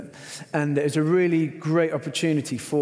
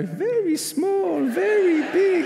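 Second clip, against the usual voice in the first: much higher, slow amused broken English; chuckling hotel worker; much slower than usual. Retold or imitated. Hotel worker leaving with the mouse, chuckling slowly. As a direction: drawl it out, chuckling. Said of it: "he's walking out chuckling".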